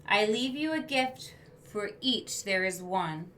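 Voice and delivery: a touch of grand farewell; creature's farewell. Acted. Creature bidding the class goodbye, lightly voiced.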